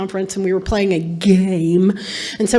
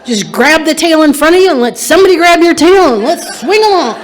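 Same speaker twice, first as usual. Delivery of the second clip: excited; 5 semitones higher, 9 dB louder than usual. Real, not acted. Cheerful call to the newcomers.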